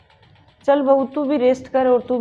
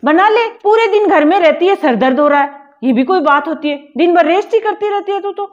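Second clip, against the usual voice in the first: scolding; 6 dB louder than usual. Acted. Mother-in-law berates elder daughter-in-law over tea, angry and loud. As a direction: dismiss her headache with an angry scold.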